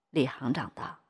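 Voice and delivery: plain reading voice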